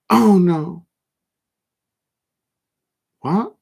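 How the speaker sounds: surprised. Real, not acted. Reacting to football news live.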